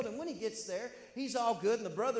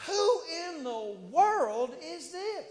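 higher, slow, drawn-out disbelief; incredulous David; 7 semitones higher, 6 dB louder, much slower than usual. Acted. David wondering at the giant, slow and incredulous. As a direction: stretch who in the world in amazement. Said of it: "David is sitting there going".

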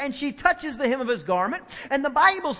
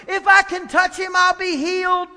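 higher, louder mock cry of the woman; mock scream; 6 semitones higher, 5 dB louder than usual. Preacher shows a scream the woman never made, loud and high. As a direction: shout it out the way she did not. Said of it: "she didn't scream with a loud voice".

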